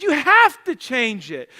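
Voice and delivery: acted loud, high, slow insistence; retold pleading. Father pleads with his tearful son, loudly insisting. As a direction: lean hard and slowly on the demand. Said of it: "I'm pleading for his action".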